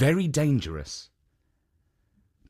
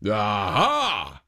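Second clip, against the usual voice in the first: ogre's triumphant aha; 4 semitones lower than usual. A narrator's reading. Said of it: "cried the Oni".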